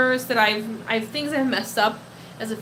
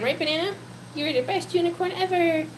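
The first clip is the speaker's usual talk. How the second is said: cooing to pet; 6 semitones higher than usual. Real, not acted. Talking sweetly to her pet.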